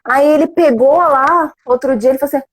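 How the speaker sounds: ordinary talk, nothing marked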